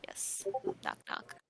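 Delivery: put-on whispered old lady voice. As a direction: hush it like a sneaky granny. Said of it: "I am using the old lady voice".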